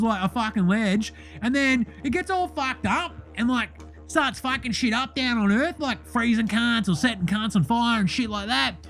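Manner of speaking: brash bogan voice throughout; Deli character voice. Acted. Host plays the character Deli.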